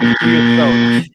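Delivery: words swallowed deep in the throat. Shown as a demonstration. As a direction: talk like gagging on your tongue. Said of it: "roll your little tongue back into your big old throat".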